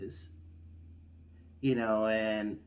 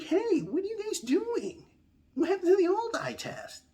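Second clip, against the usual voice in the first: outraged high voice. Retold or imitated. Interrupting the DMV staff, high indignant squeal.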